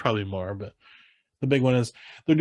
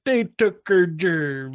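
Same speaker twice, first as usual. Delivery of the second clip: South Park line; 5 semitones higher, 3 dB louder, much slower than usual. Retold or imitated. Host comparing his Farley imitation to a South Park line, high slow mock voice. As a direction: drag out a dumb slurred complaint. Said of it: "kind of the way I said it, sounds like".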